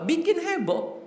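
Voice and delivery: high-pitched comic tag line; high, lightly hammed-up punchline; playful hype. Shown as a demonstration. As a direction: lift it way up, teasingly. Comic catchphrase about Dhamdham's skill.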